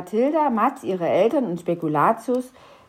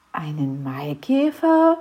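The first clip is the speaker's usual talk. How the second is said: Einen Maikäfer? breathed, low and puzzled; confused whisper; 4 semitones lower than usual. A narrator's reading. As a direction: Matz echoes it, baffled, under his breath. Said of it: "fragte Matz verwirrt". (verwirrt geflüstert)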